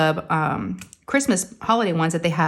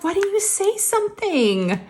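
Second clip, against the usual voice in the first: high mock question; 14 semitones higher, slower than usual. Mom asks daughter, high voice.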